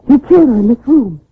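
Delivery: the fear builds as it dawns on her; rising terror. Acted. Wife realising her husband will kill her, rising terror.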